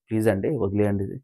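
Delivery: quietly begging a crying friend to let it go; soft pleading. Retold or imitated. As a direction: coax softly, begging. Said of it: "బతిమలాడుతుంటారు".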